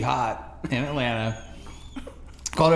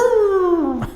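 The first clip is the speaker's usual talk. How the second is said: loud, high-pitched "brrr" noise; sudden high held whoop, imitating a noise; imitated siren burst; 9 dB louder than usual. Imitates noise before being pulled over.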